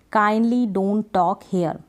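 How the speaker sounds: very slow, each word set apart; slow dictation. Shown as a demonstration. Teacher dictating an English sentence to learners, very slow. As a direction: spell it out slowly for students. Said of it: "तो kindly क्या कहेंगे".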